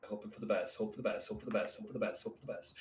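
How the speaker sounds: low, soft muttering on repeat; muttered wish. Real, not acted. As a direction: mutter it like a lucky chant under your breath. Repeated wishing before a dice roll.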